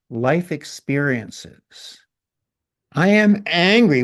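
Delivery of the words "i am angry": loud, high outburst on "angry"; emphatic angry declaration. Shown as a demonstration. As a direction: blare it out with ANGRY on top. Demonstrates anger obscuring "I am.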